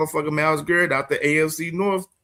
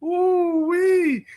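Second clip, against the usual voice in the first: high laughing whoop. Real, not acted. Cracking up again.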